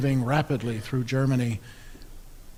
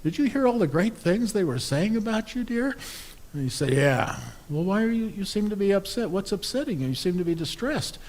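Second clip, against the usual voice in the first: high, concerned wife's voice; a wife gently pressing her husband; fussing wife; 7 semitones higher than usual. Acted. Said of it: "she turns to him and says".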